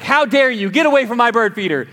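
retired teacher snapping at a bear at her feeder. Retold or imitated. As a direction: scold the bear like an unruly class. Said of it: "She flipped on the teacher voice".

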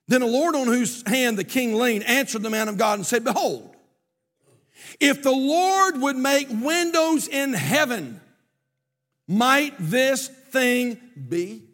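high, loud scripture declamation with drawn-out words; ringing pulpit reading; preaching. Acted. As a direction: proclaim the verse slowly and forcefully.

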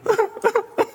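quick high-pitched chuckle; short high laugh. Real, not acted. Preacher laughing at his own point about the Hebrew word, high chuckle. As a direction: let a brief laugh slip out.

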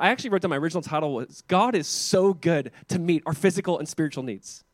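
ordinary talk

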